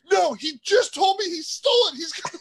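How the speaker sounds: high, loud imitated shouting; hammed-up indignant complaint; mock outraged protest. Imitating phone's owner complaining to security, mock outraged shout.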